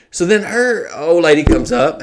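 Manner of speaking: ordinary talk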